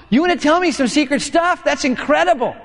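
mock amazed friend; excited. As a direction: react like a friend overjoyed. Friend thrilled by secret.

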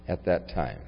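normal talking voice

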